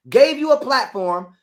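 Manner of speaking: ordinary delivery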